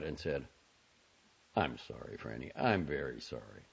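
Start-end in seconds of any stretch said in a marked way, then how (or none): 1.5-3.6 low, soft, restrained apology; subdued and formal. A narrator's reading. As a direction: apologize quietly and formally, pitched down. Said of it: "this formal apology only reactivated".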